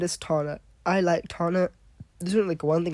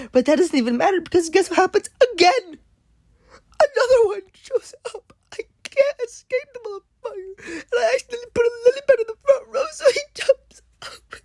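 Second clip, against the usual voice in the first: wailing; 7 dB louder than usual. Real, not acted. Overwhelmed by dolphin zombies.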